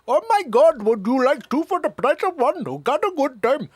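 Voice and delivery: put-on high, loud Indian accent caricature; mock Indian accent. Host showing what gets condemned, exaggerated Indian accent. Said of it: "But the moment you say".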